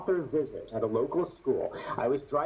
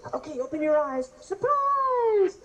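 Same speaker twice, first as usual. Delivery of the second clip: much higher and louder, excited teacher; teacher's surprise reveal; 4 dB louder than usual. Retold or imitated. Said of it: "the teacher goes".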